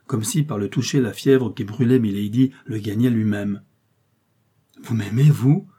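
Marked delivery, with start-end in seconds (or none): 4.8-5.7 louder, incredulous lover's question; amazed outburst; astonished cry. A narrator's reading. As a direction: exclaim it with a rising, disbelieving swing. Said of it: "s'écria-t-il".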